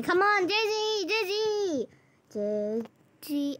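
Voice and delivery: high calling out to Dizzy. Real, not acted. Calling pet in game.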